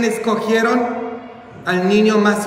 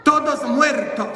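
high, drawn-out pulpit cry; dramatic proclamation; 8 semitones higher, slower than usual. Real, not acted. Preacher opens on the dead to the congregation, dramatic proclamation. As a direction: proclaim it high, letting each word ring.

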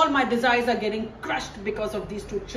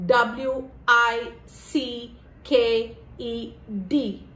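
W I C K E D spelled letter by letter, slow; spelled out slowly; much slower than usual. Shown as a demonstration. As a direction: spell W I C K E D out, each letter apart. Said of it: "By wicked, I mean".

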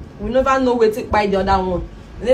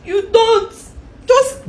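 very high, loud shriek; 13 semitones higher, 5 dB louder, much slower than usual. Acted. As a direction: scream it at him. Wife shrieks.

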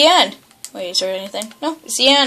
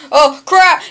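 ordinary talk, nothing marked

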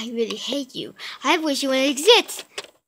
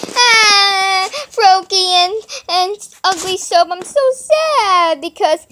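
mock crying; 6 semitones higher, 5 dB louder than usual. Kid whining and crying.

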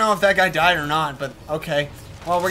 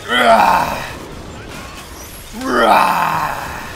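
loud, rough "rah"; hammed-up attack roar; battle roar; 4 dB louder than usual. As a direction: roar like a charging monster. Attacking enemies in game.

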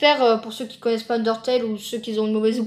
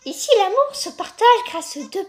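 very high flower voice; 10 semitones higher than usual. Acted. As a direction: coo like a kids' cartoon flower. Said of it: "J'espère que je fais bien la voix".

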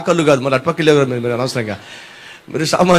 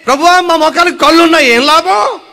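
high, loud whining complaint; mock whining; 7 semitones higher, 4 dB louder than usual. Ungrateful people to God, mock whine.

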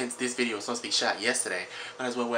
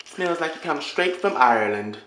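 slow, louder put-on Irish accent; mock Irish accent; 5 semitones higher, 7 dB louder, slower than usual. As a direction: ham up a fake Irish brogue, slowly. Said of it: "I'm sorry about the accent".

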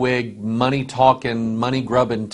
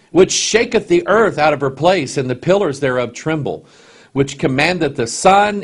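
raised, ringing King James reading, slowed on key lines; solemn scripture reading; 3 semitones higher, 3 dB louder than usual. Acted. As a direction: proclaim the verses to the congregation. Preacher reading Job aloud.

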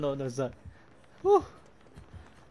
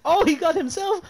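loud excited high shout; yelling across the room; 8 dB louder than usual. Real, not acted. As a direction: holler it out thrilled.

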